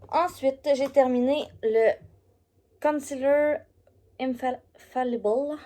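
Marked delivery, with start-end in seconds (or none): 2.8-5.6 Concealer Infallible dit en anglais avec un accent québécois, plus aigu et lent; accent franco-anglais. Real, not acted. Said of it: "Moi puis mon accent franco-anglais". (accent franco-anglais, Concealer Infallible articulé lentement)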